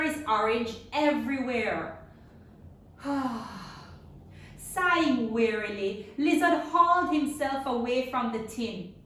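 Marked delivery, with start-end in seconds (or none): 3.0-3.8 soft tired sigh; quiet weary sigh. Acted. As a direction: sigh wearily. Lizard weary.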